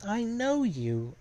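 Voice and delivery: high, drawn-out realisation; slow, high-pitched mock revelation; dawning recognition. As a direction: stretch it out high as recognition lands. Character suddenly recognises someone.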